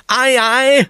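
very high, loud call back; high, sustained shout; shouted reply. A narrator's reading. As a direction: yell back eagerly to the one giving orders. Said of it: "schreit Püppi zurück".

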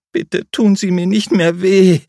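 high, loud, desperate begging; pleading. A narrator's reading. Julia begs her captor, high and loud pleading. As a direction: beg him to stop hurting her. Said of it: "flehte sie".

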